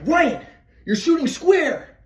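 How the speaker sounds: high, slow, drawn-out scolding; annoyed dad. Retold or imitated. Dad criticizing his son's shot, slow exasperated scolding. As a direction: stretch it out like a frustrated father. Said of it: "my dad's like".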